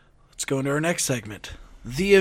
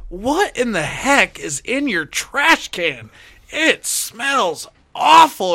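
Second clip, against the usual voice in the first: higher, slow, drawn-out complaint; imitating the neighbor; 7 semitones higher, 5 dB louder, much slower than usual. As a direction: stretch it out like a disgusted neighbor. Neighbor complaining, voiced in story.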